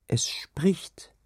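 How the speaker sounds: put-on high, hushed child's whisper; awed whisper. A narrator's reading. Child tells mother of speaking sea. (ängstliches, geflüstertes Kinderstimmchen)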